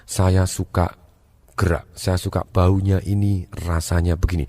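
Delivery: low, slow speech with pauses; unhurried, soft, low demo voice; feeling-type speaker. Acted. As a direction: linger on each phrase, low and soft. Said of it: "dia menggunakan pilihan katanya".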